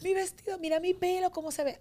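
high, whiny mock sob of a distraught bride; hammed-up tearful bride lament; imitating a crying bride. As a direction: wail it like a bride near tears over her dress and hair. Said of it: "y la novia llorando".

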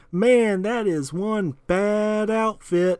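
loud, slow street hustler voice; pimp voice. Retold or imitated. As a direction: drawl it like a slick pimp. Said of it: "and he's like".

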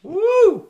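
very high, loud whoop. Real, not acted. Podcaster cheers on the friend's appeal, high loud whoop. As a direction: let out a piercing cheer.

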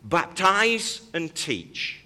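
"Baptize and teach" spelled out very slowly; slow, deliberate. Shown as a demonstration. Preacher slowly naming the two elements to the congregation. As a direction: lay out "Baptize and teach" word by word. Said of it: "Here's two elements of what we do".

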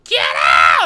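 loud guttural mock scream; screaming in character. Scare actor screaming at a kicked visitor, loud imitated scream. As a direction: belt it out like a haunted-house monster. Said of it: "she was screaming".

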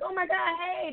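high-pitched, gushing hello; mock excited greeting. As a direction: imitate someone thrilled to run into a friend. Acting out a greeting she won't get.

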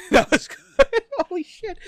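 very high, loud, helpless laughter; real cackling approval; laughing hard. Real, not acted. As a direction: barely get it out through laughter. Cracking up at the joke.

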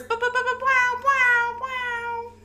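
high sung bow bow horn blasts; imitated air horn. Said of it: "that air horn app".

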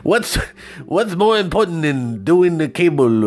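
imitated Indian accent. Salesman argues for the cable deal, faked Indian accent. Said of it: "I don't know how to do accents".

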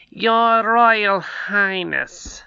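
very slow, stately address; counselor voice. A narrator's reading. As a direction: drag it out with ceremony. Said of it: "he said".

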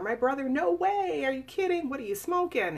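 scoffing disbelief. Retold or imitated. Survivor mimicking a family member mocking her claim, scoffing. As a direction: scoff like it's absurd, are you kidding. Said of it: "They don't wanna believe what happened".

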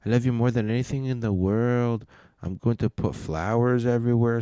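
high, hammed-up syrupy declaration of love; mock gushing lover. Streamer parodies a couple's romantic night, in a high, gushing mock voice. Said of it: "She goes".

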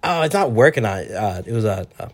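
ordinary delivery

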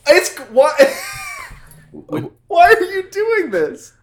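high-pitched, drawn-out cry through laughter; helplessly plead, voice shooting up with laughter; laughing exasperation. Real, not acted. Host questioning cohosts' silly riffing, laughing exasperation.

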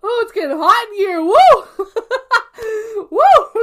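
high, loud giggly whooping; laughing. Real, not acted. Tipsy after shot, laughing.

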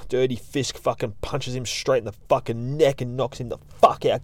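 ordinary delivery

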